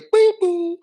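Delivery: high sung ping of the airport chime; ping ping like a PA bell; mock chime. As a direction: imitate the announcement chime high and loud. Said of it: "We just hear".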